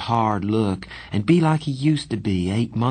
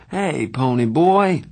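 sleepy; 6 semitones higher, 6 dB louder, slower than usual. A narrator's reading. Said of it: "he said sleepily".

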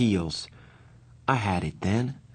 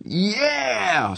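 scream; 12 semitones higher than usual. A narrator's reading. Soda screams as he flips off steps. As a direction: let out a big excited scream. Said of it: "screamed Soda".

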